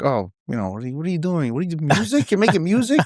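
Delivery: high, incredulous scoffing relative; mocking parent voice. Family scoffing at music career.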